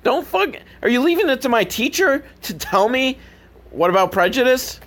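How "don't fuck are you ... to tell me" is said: ordinary delivery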